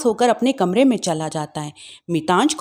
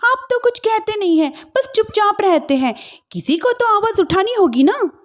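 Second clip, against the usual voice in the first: higher, accusing daughter-in-law; young wife voice; 9 semitones higher than usual. Acted. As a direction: challenge the mother-in-law. Wife answers husband.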